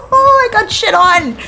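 high, laughing cries of disbelief. Real, not acted. Streamer outplayed by the killer, high laughing disbelief. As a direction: laugh it out, stunned.

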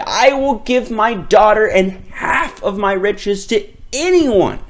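father begging for help; desperate, pleading call; despairing cry. Acted. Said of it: "In despair, he cried".

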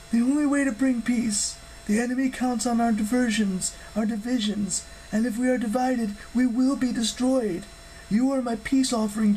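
high-pitched, earnest goddess voice; urgent divine pronouncement, high throughout; solemn goddess. Acted. Voices Hera explaining her gamble.

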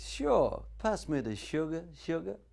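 sweet American wife asking for sugar; American wife at the next table, light American accent. Retold or imitated. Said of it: "his wife says".

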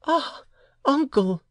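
very high woman's exclamation; exclaiming woman. A narrator's reading. Woman turns to the old uncle, exclaiming. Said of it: "exclaimed the woman".

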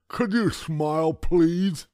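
put-on high, loud gummy old man; toothless grandpa. A narrator's reading. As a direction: ask suspiciously like a toothless grandpa. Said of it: "he asked".

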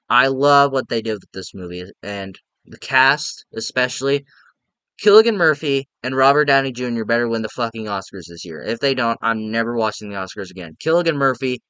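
ordinary delivery

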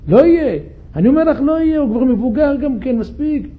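high, loud flat refusal; emphatic insistence. Acted. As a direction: slam the door on hope. Jacob insists no children will come.

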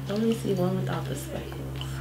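soft whisper to herself. Real, not acted. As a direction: breathe it as a whisper. Peering at the jelly beans.

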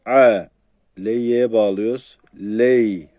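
A pressed tight in the throat, linked into Ley; squeezed throat A. Shown as a demonstration. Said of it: "A'yı sıkıyoruz tabi".

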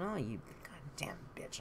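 whispered curse under the breath; muttered curse. Retold or imitated. Walking away from her mother, whispering the curse. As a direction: hiss it so she can't hear. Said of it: "walking away down the hall going".

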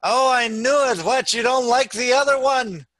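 high, loud mock-hurt complaint. Mother sees son wearing one sweater, acted reproach. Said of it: "and she said".

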